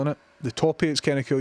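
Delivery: normal talking voice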